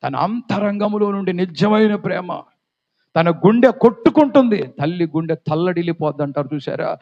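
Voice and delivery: ordinary talk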